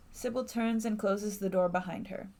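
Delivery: ordinary talk, nothing marked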